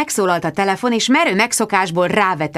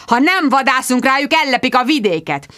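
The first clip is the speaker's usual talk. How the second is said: raised, indignant voice; heated insistence; 6 semitones higher than usual. A narrator's reading. Sir Hugo defends the hunt to his visitor, heatedly. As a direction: argue it hotly, pitched up. Squire arguing heatedly for hunting.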